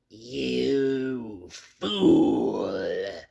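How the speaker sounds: a dead thing gloating from the tomb; hollow inhuman voice. Acted. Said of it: "the voice was deep, hollow, gelatinous, remote, unearthly, inhuman".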